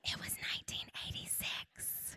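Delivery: breathy whisper, sharing a secret; hushed aside, letting the audience in; whispered confession. Acted. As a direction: whisper the year like a guilty secret. Confessing the real year.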